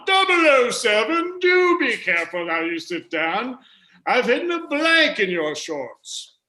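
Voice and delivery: high, slow, hammed-up British voice; British accent. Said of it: "I'm doing an accent for the purposes".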